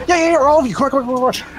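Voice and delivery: high-pitched, tumbling imitation of an excited fan; eager fan. Fan telling the whole group to come in, eager and excited. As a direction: rush them along in a high, thrilled voice. Acting the fan waving everyone in.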